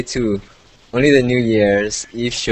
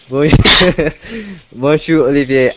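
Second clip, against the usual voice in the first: laughing; 6 semitones higher, 6 dB louder than usual. Acted. Laughing, loud French greeting.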